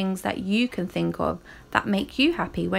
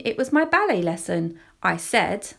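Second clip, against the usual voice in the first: ordinary delivery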